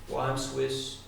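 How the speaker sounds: normal talking voice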